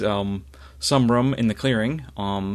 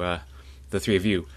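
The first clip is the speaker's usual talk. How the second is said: ordinary delivery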